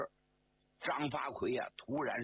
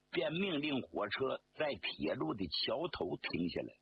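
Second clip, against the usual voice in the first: ordinary delivery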